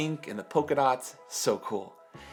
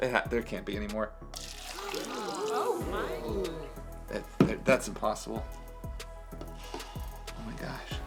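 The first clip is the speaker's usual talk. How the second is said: quiet fast amazed muttering; mock disbelief; 3 semitones lower, 6 dB quieter than usual. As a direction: act stunned the water keeps coming. Water never stops, mock disbelief.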